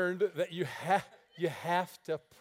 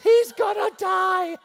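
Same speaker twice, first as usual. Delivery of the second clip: high, loud, drawn-out cry of doom; mock shout of alarm; 13 semitones higher, 13 dB louder, slower than usual. Heavenly onlookers panicking about the preacher, shouting high. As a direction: yell it like a disaster is coming.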